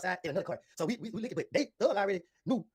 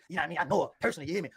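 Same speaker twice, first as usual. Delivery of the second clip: ordinary talk, nothing marked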